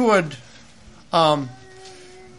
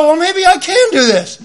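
higher, louder hesitant thought; hopeful musing; 9 semitones higher, 4 dB louder than usual. Retold or imitated. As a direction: talk yourself into it. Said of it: "And I thought".

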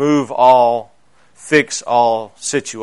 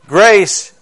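proclaimed; 7 semitones higher, 8 dB louder than usual. Real, not acted. Preacher proclaims the key word.

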